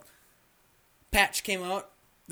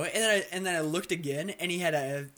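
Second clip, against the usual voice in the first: ordinary talk, nothing marked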